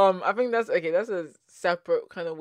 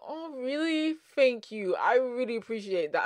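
mock-flattered gratitude; 8 semitones higher than usual. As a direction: gush as if handed a lovely compliment. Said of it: "like, I'm like".

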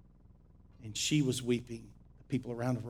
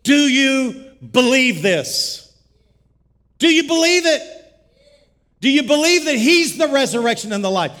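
shouted, much higher and louder preaching; preaching at full force; 12 semitones higher, 18 dB louder than usual. Acted. Pastor preaching to the congregation about not dying, full-force shouting. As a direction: thunder it at the congregation like a revival preacher.

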